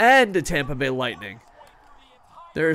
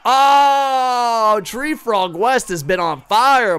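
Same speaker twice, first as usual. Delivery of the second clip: excited; 8 semitones higher, 8 dB louder, much slower than usual. Real, not acted. Hypes a player scoring.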